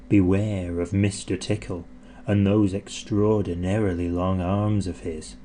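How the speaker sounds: ordinary delivery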